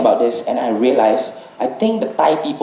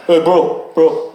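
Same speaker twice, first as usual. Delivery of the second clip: higher, laughing bro coach; lightly mock the pushy gym coach; head coach bro voice; 3 semitones higher, much slower than usual. Comic calling the coach's bro talk.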